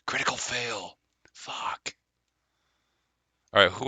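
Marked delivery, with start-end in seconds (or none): 0.1-1.9 soft, breathy mock dismay; whisper-shout the bad roll, dropping to pure breath. Shown as a demonstration. Pitching dice-roll ASMR to the group, whispered exclamation. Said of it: "whisper shout things".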